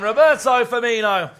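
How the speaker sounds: loud, slow, drawn-out name call; excited name call. Real, not acted. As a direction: belt out the scorer's name. Commentator calls the scorer's name.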